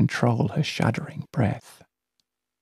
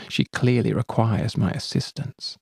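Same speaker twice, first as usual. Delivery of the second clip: ordinary delivery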